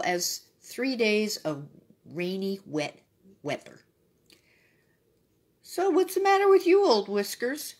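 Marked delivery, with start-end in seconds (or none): 5.6-7.7 donkey asking. A narrator's reading. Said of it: "asked the donkey".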